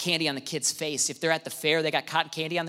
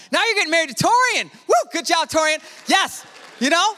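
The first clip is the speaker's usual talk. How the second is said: excited cheer; 12 semitones higher, 4 dB louder than usual. Real, not acted. Celebrating the engaged couple.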